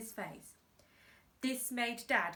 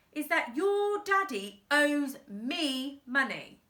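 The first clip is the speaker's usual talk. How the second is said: slow gloat; 5 semitones higher, 5 dB louder than usual. Acted. Boss gloats slowly.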